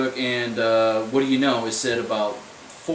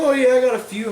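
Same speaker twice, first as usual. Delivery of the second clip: high, louder mimicry of her reply; mock girlfriend; 10 semitones higher, 5 dB louder than usual. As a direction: imitate her airy admission, pitched way up. Retells her casual admission.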